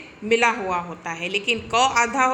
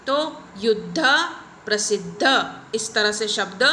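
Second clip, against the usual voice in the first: ordinary delivery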